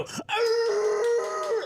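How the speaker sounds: very high loud cartoon wolf yell; cartoon wolf howl. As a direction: howl like a Hanna-Barbera wolf. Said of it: "like the wolf from the".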